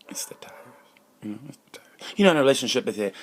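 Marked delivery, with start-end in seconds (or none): 0.1-2.1 very soft, secretive aside; confidential whisper. Pretend. Host quietly tips off listeners about the tires, hushed. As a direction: breathe it out like a secret.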